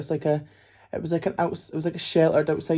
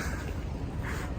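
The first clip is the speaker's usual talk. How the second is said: breathy nervous laugh; 11 dB quieter than usual. Real, not acted. Awkward about asking for photo.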